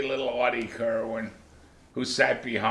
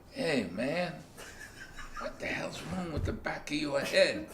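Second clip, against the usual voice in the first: retold whisper; 5 semitones higher, 8 dB quieter than usual. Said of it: "he whispered in my ear".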